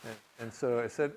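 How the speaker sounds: ordinary delivery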